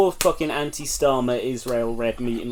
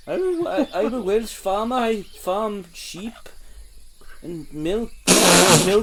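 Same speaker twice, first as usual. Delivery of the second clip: put-on Welsh farmer accent; 5 semitones higher than usual. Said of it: "What about a Welsh farmer?".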